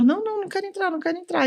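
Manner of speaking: high, soft imitation of a reluctant girl; lightly voiced refusal, pitched well up; mock reluctant athlete. Athlete balking when the coach calls her in, mock reluctant.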